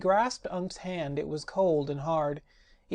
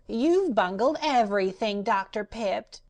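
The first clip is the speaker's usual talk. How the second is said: contemptuous cat; 4 semitones higher, 3 dB louder than usual. Acted. Glass cat sneering at Doctor Pipt, high and scornful. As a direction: sneer at the magician. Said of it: "added the glass cat contemptuously".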